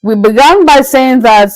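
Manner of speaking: ordinary talk, nothing marked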